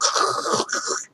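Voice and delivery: soft voiceless crackling bursts; imitated monitor static. Imitating the wand on the bad ankle, voiceless static. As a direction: crackle like a probe finding nothing. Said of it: "You can hear it going".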